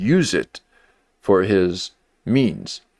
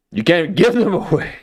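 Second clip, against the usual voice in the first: laughing; 7 semitones higher than usual. Real, not acted. Laughing at his own joke.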